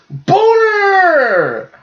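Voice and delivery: put-on very high, loud squeaky toddler shout; toddler yells. Retelling the kid's punchline, high loud gleeful shout. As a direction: scream the word like a gleeful three-year-old. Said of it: "He goes".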